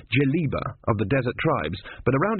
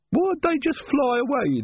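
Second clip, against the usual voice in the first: demanding; slower than usual. A narrator's reading. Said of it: "the woman demanded".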